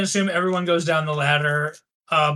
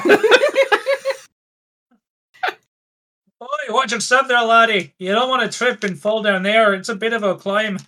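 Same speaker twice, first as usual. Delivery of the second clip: higher, caring Scottish warning; Scottish accent; 5 semitones higher, 3 dB louder than usual. Acted. GM as Manda warning Anorin on the ladder in a Scottish accent. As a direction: warn the lad in a Scottish accent. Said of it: "to escape the accents".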